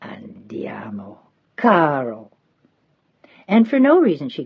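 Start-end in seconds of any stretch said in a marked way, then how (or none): 0.0-2.3 whispered Andiamo. A narrator's reading. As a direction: breathe it gently, slowly. Old woman leading girl home.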